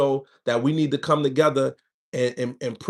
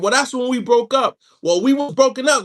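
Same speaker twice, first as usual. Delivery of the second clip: defensive arguing; 7 semitones higher, 4 dB louder than usual. Acted. Acted quarrel with girlfriend.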